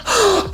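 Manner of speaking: high, theatrical gasp; hammed-up shocked gasp. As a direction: gasp as if suddenly recognizing someone. Acting his shocked recognition.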